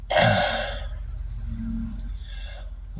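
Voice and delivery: long, breathy sigh; heavy sigh. Real, not acted. Streamer pausing mid-rant, heavy sigh. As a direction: let out a long exhale. Weary pause during angry rant.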